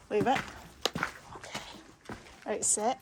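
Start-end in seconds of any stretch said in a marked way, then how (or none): none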